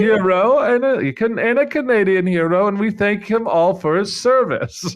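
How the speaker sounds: starts high and strong, slips back into his own voice; a mock German accent losing confidence; fading foppish German accent. Asked to read the speech in a foppish German accent, fading out. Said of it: "[name]'s impersonations. They all trail off".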